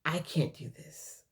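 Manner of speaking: broken, whispered give-up, fading out; despairing whisper. Retold or imitated. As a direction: breathe it out as if crying. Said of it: "sitting and crying".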